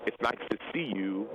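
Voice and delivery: slow, gentle retold reassurance. Counselor to a client in tears, imitated gently. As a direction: take it slowly, pausing before the name.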